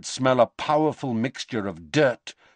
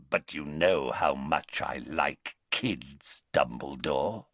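lower, softer and slow, relishing; leering werewolf; 6 semitones lower, 6 dB quieter than usual. Acted. Greyback to Dumbledore, a low relishing leer. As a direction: drool over the words.

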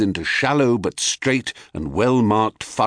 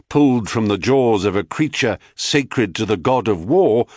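usual speaking voice

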